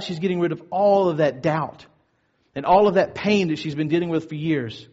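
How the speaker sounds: ordinary talk, nothing marked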